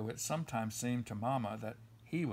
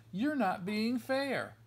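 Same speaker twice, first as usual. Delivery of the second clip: higher and slow, a whiny cub protest; sputtering protest; 8 semitones higher, slower than usual. Acted. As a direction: drag out the complaint like an upset child. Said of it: "sputtered the Cubs".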